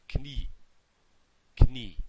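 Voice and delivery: Knie with the k clearly heard; German Knie with spoken k; sounded k in kn. Shown as a demonstration. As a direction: pop the k before the n in Knie. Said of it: "is actually gonna have a kn".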